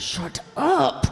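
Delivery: sharp hushed snap at her husband; snapped shut up. Acted. Wife hissing at husband during aunt's call, sharp snap. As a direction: silence him fast without the phone hearing.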